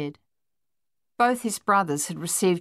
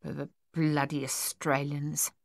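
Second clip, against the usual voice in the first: soft, stammered grumble; stuttering mutter; 3 semitones lower, 6 dB quieter than usual. A narrator's reading. Luther grumbling after the thieves escape, muttered stammer. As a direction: mutter it softly, stuck on the B. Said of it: "Luther muttered".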